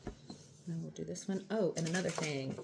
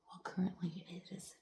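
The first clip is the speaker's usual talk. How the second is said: late-night whisper so nobody wakes; hushed whisper, parents asleep nearby; 6 dB quieter than usual. Real, not acted. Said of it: "Sorry, I'm whispering".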